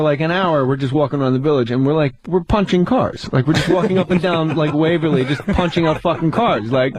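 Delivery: ordinary talk, nothing marked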